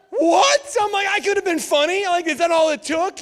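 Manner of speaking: shrieking high, loud indignation; the wronged older brother protests to his parents; outraged disbelief. Retold or imitated. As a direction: cry out at the unfairness. Indignant at unfair forgiveness.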